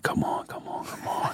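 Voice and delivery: soft whispered come on; hushed, pleading under breath; whispered plea. Retold or imitated. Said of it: "I'm like".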